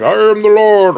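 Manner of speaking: mock divine voice; booming Lord voice. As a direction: announce yourself as God. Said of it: "I was like".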